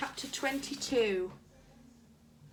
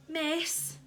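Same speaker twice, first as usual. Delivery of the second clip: higher, mild call for attention; 7 semitones higher than usual. A narrator's reading. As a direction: call out to a teacher without shouting. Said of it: "he called out".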